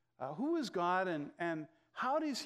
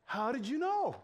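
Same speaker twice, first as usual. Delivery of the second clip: raised, lightly voiced woman asking in surprise; surprised woman's question; 6 semitones higher, 3 dB louder than usual. Real estate agent at the door, caught out, asks with mock surprise. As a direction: let her surprise lift the question.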